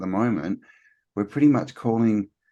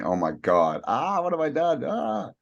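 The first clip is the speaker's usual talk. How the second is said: mock panic; 7 semitones higher than usual. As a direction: voice shooting high into a panicked wail. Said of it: "or you're thinking".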